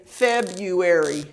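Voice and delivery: February said slowly as feb-yoo-ary, no R; silent R. Shown as a demonstration. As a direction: drop the first R in February, slow and clear. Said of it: "this R right here is really silent".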